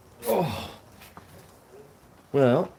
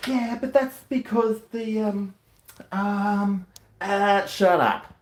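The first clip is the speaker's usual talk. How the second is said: mock greenie; 9 semitones higher, slower than usual. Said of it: "That'll throw the greenies off".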